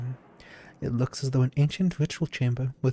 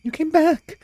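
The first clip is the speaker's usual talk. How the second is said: excited shout; 3 dB louder than usual. Acted. Wraith overjoyed at return.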